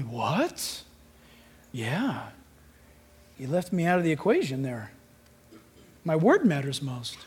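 压低声音，轻柔而郑重; 低声平静地点明; hushed gentle Jesus. Pretend. Quiet voice of Jesus.

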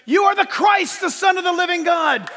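high, ringing proclamation; fervent preacher's shout; shouted declaration. Retold or imitated. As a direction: declare it at the top of your voice. Peter's declaration acted in sermon.

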